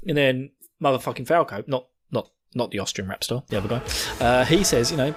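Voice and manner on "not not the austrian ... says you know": usual speaking voice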